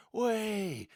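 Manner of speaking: high whooping cheer from the players. Retold or imitated. Players celebrating the draw, high whooping cheer. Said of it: "and the players are all".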